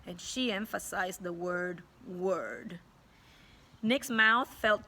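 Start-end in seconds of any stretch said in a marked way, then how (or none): none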